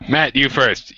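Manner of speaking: normal talking voice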